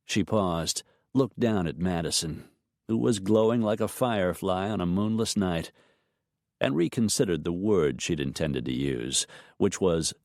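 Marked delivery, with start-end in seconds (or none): none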